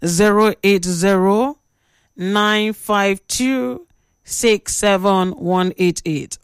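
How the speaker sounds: number read out slowly, digit by digit; slow dictation. Shown as a demonstration. Radio host dictating the WhatsApp number slowly to listeners. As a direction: spell it out slow so listeners can write it. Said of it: "write this WhatsApp number down".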